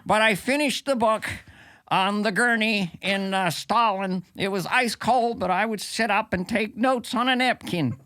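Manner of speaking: lightly voiced Peterson accent, laughing through it; a weak stab at Peterson's Canadian accent; bad Jordan Peterson accent. Comic imitating Jordan Peterson plugging his book, bad Canadian accent. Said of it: "It's not my best accent".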